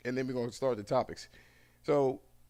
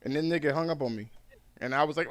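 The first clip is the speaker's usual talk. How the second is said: usual speaking voice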